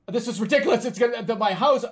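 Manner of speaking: loud, high retold panic; acted panic. Dreamer reacting to a jet under the house, acted panic. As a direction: blurt it out like the jet is coming at you.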